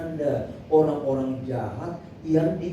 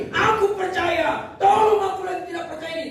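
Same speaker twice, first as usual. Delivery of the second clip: high, acted cry for help; desperate shout; 11 semitones higher than usual. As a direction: shout it out as a broken-hearted father pleading. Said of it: "Teriak dia". (berteriak memohon dengan nada tinggi)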